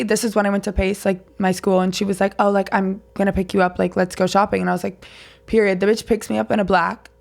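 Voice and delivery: ordinary talk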